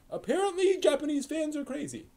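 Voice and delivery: an American exec marveling at Japanese fans, voiced high and incredulous; high mock-incredulous quote. Producers gossiping about crazy fans, in a high mock-amazed voice. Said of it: "they were like".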